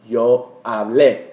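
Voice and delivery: yo hablé, slow, with the stress landing on the final é; slow teacher demo of hablé with final stress. Shown as a demonstration. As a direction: stretch out hablé and hit the last syllable. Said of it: "make sure you accent that E".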